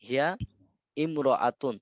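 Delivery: "হিয়া", a pause, then "ইমরাতুন" with its "i" sounded; separated pronunciation. Shown as a demonstration. Teacher contrasts forms for student, "হিয়া ইমরাতুন" said word by word. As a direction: break "হিয়া ইমরাতুন" into two distinct words. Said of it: "এমন হওয়ার কথা ছিল না".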